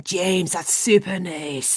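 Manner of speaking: slow, stretched Scottish praise; celebrating in Scottish accent. Retold or imitated. Coach celebrating what James is doing, Scottish accent. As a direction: celebrate him in mock Scottish, drawn out. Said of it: "That was a good example of celebrating".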